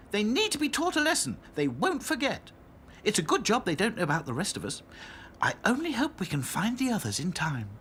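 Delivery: high, lively doll voice; Dotty's keen voice. A narrator's reading. Dotty backing the plan against the princess dolls, keen.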